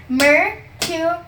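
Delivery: slow, drawn-out model word; slow demo. Shown as a demonstration. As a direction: stretch it out for the kid. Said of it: "the next word is mercurial".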